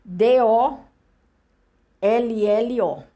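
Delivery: letter by letter, slow and clear; spelling it out. Shown as a demonstration. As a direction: spell the name out one letter at a time. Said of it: "Eu vou soletrar aqui".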